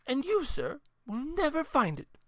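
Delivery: put-on high, teasing old lady challenge; a grandmother daring him to try. A narrator's reading.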